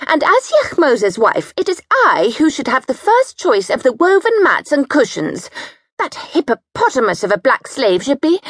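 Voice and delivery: bossy sister-in-law ranting over cushions; high domineering wife. A narrator's reading. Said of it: "high, domineering and bullying".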